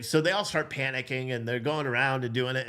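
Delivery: ordinary delivery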